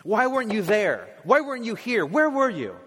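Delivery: mild mock yell of grief; mourners yelling at God. Pastor voicing mourners crying out at God, a mild anguished yell. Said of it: "yell at God".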